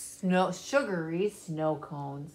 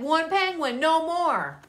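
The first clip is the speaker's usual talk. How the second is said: higher, firm bear voice; stern bear; 8 semitones higher, 3 dB louder than usual. A narrator's reading. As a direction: repeat the rule sternly. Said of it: "He said".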